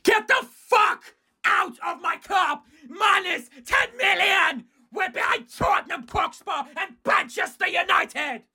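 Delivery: a high, slow, crying yell; tearful angry shout. Real, not acted. Fan raging at the manager and the table, crying shout. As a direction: bellow through tears of fury. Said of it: "I'm so sorry for being angry".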